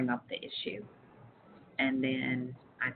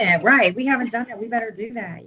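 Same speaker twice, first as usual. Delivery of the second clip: eager realization; 8 semitones higher, 8 dB louder than usual. Retold or imitated. Coworkers realizing, voiced agreement.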